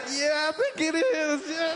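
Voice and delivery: high, slow, bashfully pleased; a touch of shy pride; flattered reply. Retold or imitated. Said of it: "And you'll be like".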